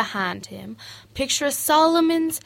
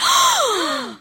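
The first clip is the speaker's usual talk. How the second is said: high startled gasp; sharp gasp. Retold or imitated. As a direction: gasp as if caught. Gasp before denial.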